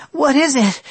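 high, out of breath question; breathless. A narrator's reading. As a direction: ask it panting from running. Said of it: "she asked breathlessly".

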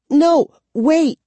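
high, loud alarmed plea; urgent cry. A narrator's reading. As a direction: cry out to stop them. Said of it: "Kara cried".

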